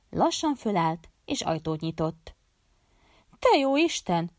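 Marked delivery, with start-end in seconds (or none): 3.4-4.3 loud startled cry, pitched high. A narrator's reading. Doctor opening the door at night, crying out in alarm. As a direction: blurt it out in shock. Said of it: "kiáltott föl".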